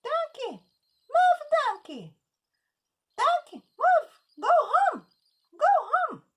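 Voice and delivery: very high, loud commanding yell; shouting at donkey. Acted. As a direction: order the donkey to move at full voice.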